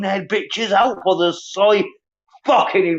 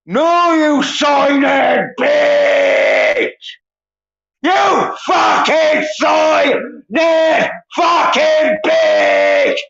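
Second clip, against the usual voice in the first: real furious yelling, louder and higher, slow; furious shouting; 4 semitones higher, 4 dB louder, much slower than usual. Real, not acted. Streamer screaming abuse at his viewers, real furious yelling. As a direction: scream it at them, full rage.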